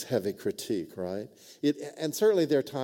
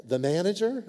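startled high question; mock-shocked operator asking back; incredulous rising repeat; surprised echo; 8 semitones higher, 4 dB louder than usual.

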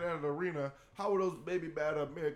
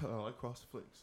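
ordinary talk, nothing marked

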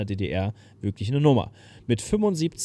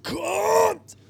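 hoch und laut gerufen; Bühnenruf; 5 dB louder than usual. Retold or imitated. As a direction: ruf es wie die Sängerin. Imitiert erneut den Bühnenruf. (Erzähler ruft wie die Sängerin, hoch und laut)

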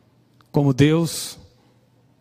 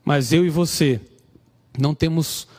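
put-on raised-pitch sermon delivery to young listeners; preaching; 4 semitones higher than usual. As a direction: preach the lesson, pressing each point home. Preaching the lessons of Samson.

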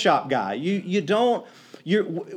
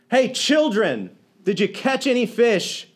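calling out; 5 semitones higher, 3 dB louder than usual. Retold or imitated. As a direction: call across the water to the boat. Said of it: "Some guy cries out".

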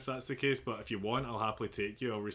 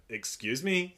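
a sharp, indignant "Excuse me?", higher and louder; retold offended comeback, pitched up and louder; semi-aggressive retort; 6 semitones higher, 6 dB louder, slower than usual. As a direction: bristle back at him in mock shock. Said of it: "felt semi-aggressive".

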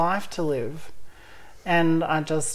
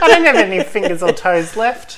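laughing; 4 semitones higher, 9 dB louder than usual. Real, not acted. Joking about dating count.

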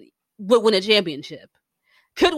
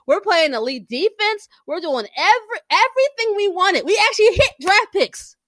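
high-pitched exasperated ranting; rising frantic list; spiraling; 8 semitones higher than usual. Real, not acted. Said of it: "And I am spiraling".

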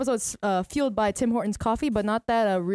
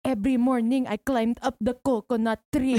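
comic Filipino accent, playing the hard worker. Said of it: "Filipino accents is just so easy to do".

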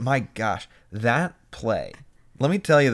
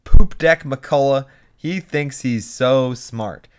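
mock taunt; 4 semitones higher, 9 dB louder, slower than usual. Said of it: "Millie then says to him".

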